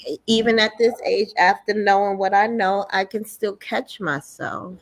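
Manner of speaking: ordinary delivery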